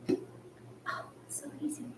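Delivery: whispered, playfully smug; mock-casual whisper. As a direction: whisper it like it was nothing. Mock effortless after struggling.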